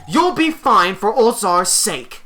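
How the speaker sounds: urgent game elder. Acted. Elder sends the children off to the woods, urgent. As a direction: press them to go, as the elder.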